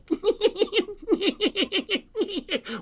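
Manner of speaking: high, tittering giggle; chuckling stars. A narrator's reading. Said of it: "chuckled to themselves".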